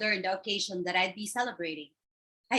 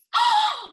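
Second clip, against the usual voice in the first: sharp, very high loud gasp; high offended gasp; shocked gasp; 6 dB louder than usual. Acted. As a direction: gasp in outrage. Sister insulted, gasps.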